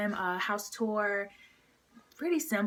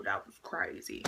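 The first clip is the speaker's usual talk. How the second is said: low, dropped-voice reaction; stunned aside; 13 semitones lower, 7 dB quieter than usual. Retold or imitated. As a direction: let the voice sink low and soft, stunned. Retold stunned reaction to outburst.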